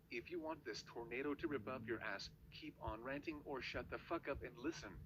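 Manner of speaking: ordinary delivery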